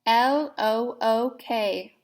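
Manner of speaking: letters spelled out slowly and evenly; slow spelling. Shown as a demonstration. Teacher leading children in slow spelling. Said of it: "Here's the word again".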